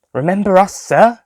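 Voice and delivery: high, mocking sing-song; sarcastic. A narrator's reading. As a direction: tease him with fake politeness. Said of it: "Lisa asked sarcastically".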